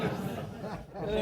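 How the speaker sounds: loud laugh. Real, not acted. Preacher laughs at granddad's excuse. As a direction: laugh at the story.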